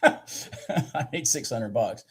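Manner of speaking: slow, laughing through the words. Real, not acted. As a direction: chuckle it out at half pace. Repeats result laughing.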